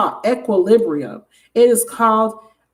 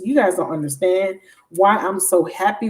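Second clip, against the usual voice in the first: ordinary talk, nothing marked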